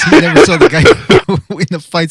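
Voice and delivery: helpless laughter breaking into the words; laughing while talking. Real, not acted. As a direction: tell it through real laughter. Breaks up laughing telling friend's story.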